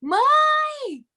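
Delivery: high, drawn-out call to mother; acted-out cry of alarm, stretched slow; retold yell for mom. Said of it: "Aí eu grito pra minha mãe".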